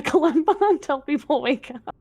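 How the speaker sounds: normal talking voice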